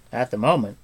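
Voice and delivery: a bard groaning from exhaustion; loud groan. A narrator's reading. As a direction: let it out as a weary groan. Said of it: "groaned Fluder".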